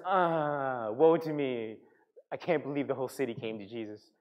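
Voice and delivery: half-hearted woe-is-me lament; mock complaining. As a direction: groan it like a sulking prophet. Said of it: "starts continuing to complain".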